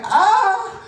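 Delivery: high, loud, hammed-up wail of a hurt mom; mom crying out. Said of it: "when she woke me up crying".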